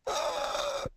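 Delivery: high, airy gasp of disbelief; mock horrified gasp. Hotel clerk reacting to the guest's story with a gasp. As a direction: gasp as if it's outrageous.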